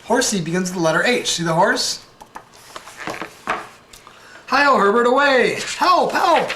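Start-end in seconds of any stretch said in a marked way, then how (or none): 4.5-5.6 higher, louder, a hearty mock cowboy yell; cowboy rallying call. Cowboy rides off, calling out. 5.8-6.5 a high, loud, hammed-up cry for help; mock cry for help. Character in trouble.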